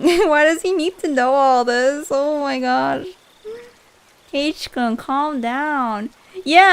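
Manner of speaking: loud, drawn-out, laughing complaint; playful exasperation. Real, not acted. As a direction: scold a character you are fed up with. Playfully scolds the game character.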